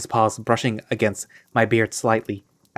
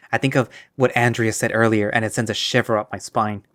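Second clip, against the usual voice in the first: ordinary delivery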